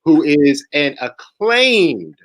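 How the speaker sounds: slow, stately announcer build-up; grand slow intro; formal introduction. Real, not acted. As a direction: draw out each phrase for the guest. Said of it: "formal build-up to speaker introduction".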